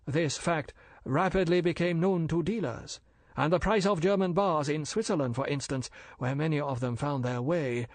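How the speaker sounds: polite and bland, a dealer explaining; bland Chinese merchant. Acted. Older Fu brother lecturing the major, smooth and polite.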